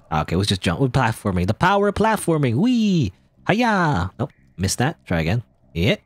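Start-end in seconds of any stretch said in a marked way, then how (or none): 1.5-4.1 gleeful cheers. Acted. Jumping while platforming game.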